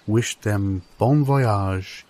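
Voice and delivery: usual speaking voice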